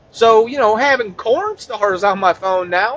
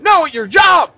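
high, raised-voice correction; exasperated yelling; 6 semitones higher, 4 dB louder than usual. Acted. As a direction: yell it at someone who misunderstood. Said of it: "yelled at him so hard".

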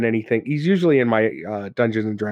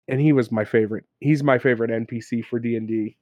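usual speaking voice

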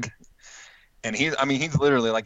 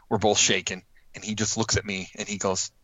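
normal talking voice

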